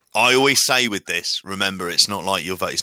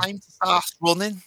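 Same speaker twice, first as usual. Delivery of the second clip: higher, hammed-up Scouse accent, quickly given up; a reluctant Scouse game-show host on request; Scouse impression; 10 semitones higher than usual. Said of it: "Come on, do it, J P".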